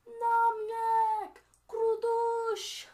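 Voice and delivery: long, high, very loud warning cry; elves' yell. Acted. Elves yell as arrows hit the orcs, warning yell. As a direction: hold each word out in a ringing shout. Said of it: "What do the elves yell out".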